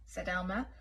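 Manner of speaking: ordinary delivery, nothing marked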